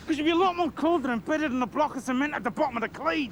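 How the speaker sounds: quick rising menace; fast threat. Acted. Gangster to Sinclair, fast menacing threat. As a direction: rattle off the threat.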